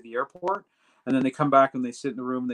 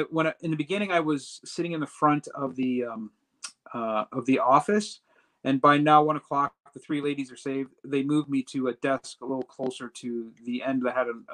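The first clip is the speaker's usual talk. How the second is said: ordinary talk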